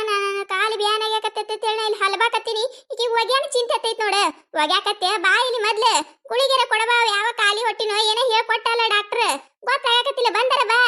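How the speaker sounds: high, whining mother-in-law voice; demanding mother-in-law. Acted. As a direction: nag for attention over an aching leg.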